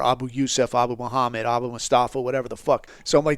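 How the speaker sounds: usual speaking voice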